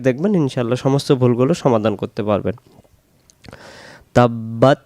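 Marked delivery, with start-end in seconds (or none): 4.2-4.8 drawn-out melodic recitation; chanted Quranic recitation; slow Quran recitation. Shown as a demonstration. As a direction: recite it slowly and devoutly. Recites verse slowly.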